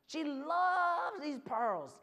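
"loves" drawn out long and high; stress on loves. A narrator's reading. Storyteller stressing the girl's delight, "loves" drawn out. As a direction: stretch "loves" slowly with relish.

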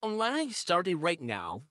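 ordinary talk, nothing marked

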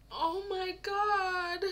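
real tears at the screen; crying. Real, not acted. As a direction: choke up as it lands. Reacting to sad scene.

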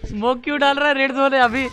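loud, high exasperated yell; frustrated shout. Real, not acted. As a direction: yell it in disbelief.

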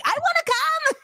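very high-pitched eager squeal; hammed-up eagerness. Host asking rappers to take her along, mock eager. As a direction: pipe up high like a keen tagalong.

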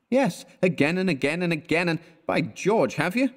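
high, needling repetition; mock him for falling again; scornful friend teasing; sarcastic exasperation. Elliot's sarcastic outburst.

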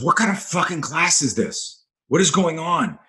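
retold outburst, high but not shouted; retold outraged scream. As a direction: imitate an indignant woman demanding answers. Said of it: "screams at me".

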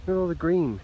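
excited. Real, not acted. Excited at the greenery.

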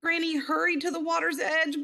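ordinary delivery, nothing marked

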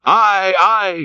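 high, drawn-out ayes; crew's shout. Acted. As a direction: shout assent like a whole crew. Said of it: "shouted the harpooneers and the seamen".

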